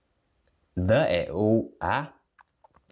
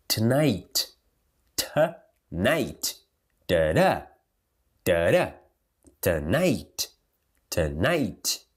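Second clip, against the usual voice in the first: "Tonight" with a weak "tuh" and strong "NIGHT"; second-syllable stress; much slower than usual. Shown as a demonstration. Teacher drilling word stress, "Tonight" stressed on "night". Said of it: "Sílaba tônica vem aqui".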